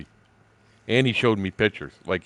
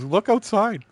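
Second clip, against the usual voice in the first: excited call; 13 semitones higher, 5 dB louder, slower than usual. Retold or imitated. Telling friend to look outside.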